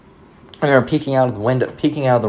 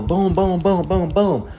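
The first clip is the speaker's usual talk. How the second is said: high, punchy boom boom knocks; mock door banging; 8 semitones higher, much slower than usual. Kid recalling the skinhead pounding on the door, mock booms. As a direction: knock out each boom like a fist on wood.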